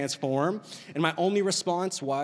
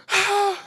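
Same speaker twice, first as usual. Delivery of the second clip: high, loud mock gasp; imitated shocked gasp; 5 dB louder than usual. Husband imitating his wife after the scare, shocked gasp. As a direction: gasp sharply as the startled wife. Wife's shock before crying.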